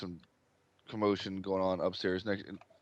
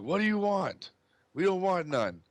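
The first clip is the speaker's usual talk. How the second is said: higher-pitched irate man at the door; stern, angry father; 11 semitones higher, 5 dB louder than usual. Acted. Father confronting strangers at his door, stern and angry. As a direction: play a father angrily turning callers away. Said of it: "very stern, angry look on his face".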